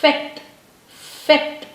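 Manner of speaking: fête as "fet", said singly with a gap; French pronunciation. Shown as a demonstration. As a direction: open ê, crisp t, silent e in fête. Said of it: "this is silent letter".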